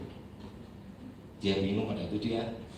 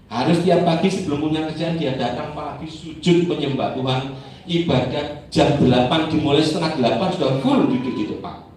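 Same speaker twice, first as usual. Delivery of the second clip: louder, forceful preaching; 7 dB louder than usual. Acted. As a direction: drive the warning home to the church.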